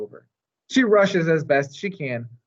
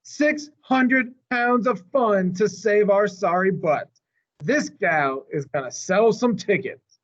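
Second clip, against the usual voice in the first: showman boss; 8 semitones higher than usual. Acted. Mac announces the new elephant to the crowd, brash barker. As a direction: sell it like a carnival barker.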